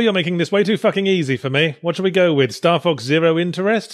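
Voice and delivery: ordinary delivery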